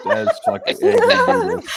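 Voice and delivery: high laughing squeal; slow, laughing repeat of the joke. Real, not acted.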